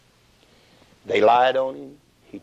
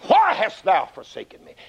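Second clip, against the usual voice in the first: crying out; 8 semitones higher than usual. Acted. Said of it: "Jesus screamed out".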